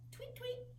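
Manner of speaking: very high, soft little tweets; high bird tweet. Shown as a demonstration. Teacher showing children a bird's tweet, high and soft. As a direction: chirp it like a tiny songbird. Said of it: "your animal sounds".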